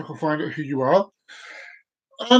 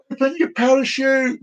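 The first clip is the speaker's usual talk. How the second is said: calling down; 7 semitones higher than usual. Acted. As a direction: call out to someone far below. Instructor calling down again.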